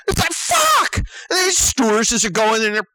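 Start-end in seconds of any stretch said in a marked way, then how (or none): 0.3-1.0 very high-pitched frustrated yelp; retold exasperated outburst; exasperated cry. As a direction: burst out in comic frustration.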